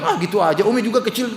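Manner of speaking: high, offhand brush-off with a laugh; dismissive mother. Acted. Mother shrugging off her child's effort, high and laughing. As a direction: shrug it off as nothing.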